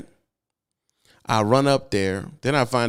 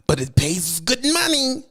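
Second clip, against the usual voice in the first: much higher, slow, wheedling; pushy dispatcher; 12 semitones higher, 4 dB louder than usual. Shown as a demonstration. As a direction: dangle the money slowly and sweetly. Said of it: "this is how it sounds".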